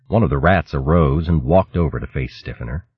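usual speaking voice

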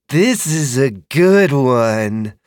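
drawn-out creature approval; pleased dragon voice. Acted. Dragon insists.

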